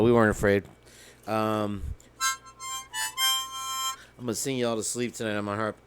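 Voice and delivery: usual speaking voice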